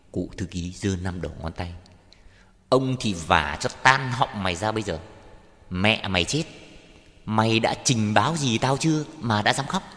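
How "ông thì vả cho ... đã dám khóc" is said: loud, hectoring scolding; angry raised voice, bearing down on a subordinate; enraged threat. Acted. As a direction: threaten like a bullying official. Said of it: "Hai mắt long lên".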